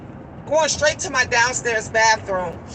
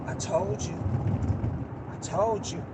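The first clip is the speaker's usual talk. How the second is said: very soft, low aside; hushed I-told-you-so; 7 semitones lower, 10 dB quieter than usual. Retold or imitated. Daughter to her friend caught out, hushed reproach. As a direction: murmur it low under your breath.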